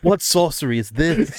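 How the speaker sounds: high, slow dramatic awe; hammed-up medieval wonder; mock awe.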